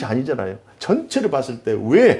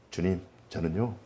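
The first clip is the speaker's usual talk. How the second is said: soft, meek imitation of Peter; mild polite quote; 7 semitones lower, 15 dB quieter than usual. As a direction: say it tamely, like a polite disciple. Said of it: "이렇게 점잖하게 얘기". (점잖게 조용히)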